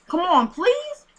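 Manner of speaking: high-pitched plea, rising at the end; acted pleading. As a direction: beg as the desperate caller. Acting out begging for baby's location.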